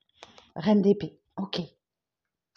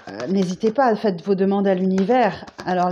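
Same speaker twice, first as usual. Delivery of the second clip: normal talking voice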